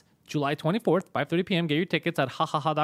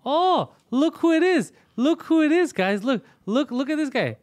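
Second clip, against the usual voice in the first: high, slow, loud mock announcement; mocking high call-out; 11 semitones higher, 6 dB louder, slower than usual. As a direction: sing out his arrival to tease him. Mocking a guest on air.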